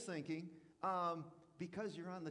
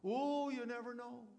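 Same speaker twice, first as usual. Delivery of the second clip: mock insinuating warning; 6 semitones higher, 5 dB louder than usual. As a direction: plant doubt with a fake worried warning. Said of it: "here's what you'll say".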